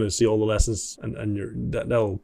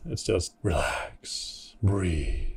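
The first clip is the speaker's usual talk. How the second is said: slow and much softer, letting the breath out; slow calm breath; 4 semitones lower, 8 dB quieter, much slower than usual. Shown as a demonstration. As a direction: show him how to relax, drawn out and quiet. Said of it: "Just slow it down. Relax".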